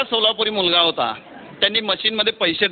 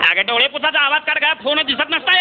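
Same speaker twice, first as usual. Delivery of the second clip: scolding brother on the phone; 6 semitones higher than usual. Retold or imitated. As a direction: tell her off then calm her. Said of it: "फक्त ताईच म्हणाला".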